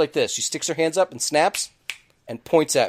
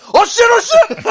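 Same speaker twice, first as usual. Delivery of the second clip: high, loud, panicked shout; oh no, he's opening the plane door; panicked yell; 10 dB louder, faster than usual. Retold or imitated. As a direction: yell in sudden alarm as the door opens.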